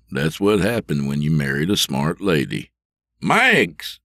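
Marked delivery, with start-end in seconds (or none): 3.2-4.0 calling his wife's name from another room, voice raised. A narrator's reading. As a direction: call out Mags across the house. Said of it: "he called".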